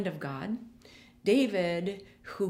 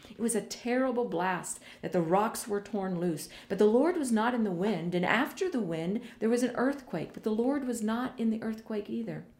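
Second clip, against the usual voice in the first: ordinary talk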